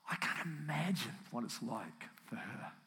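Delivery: soft, low, choked with feeling; emotional. Real, not acted. Preacher feeling for the bereaved wife, softly emotional. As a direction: let grief pull the voice down and quiet. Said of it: "it's a very emotional morning".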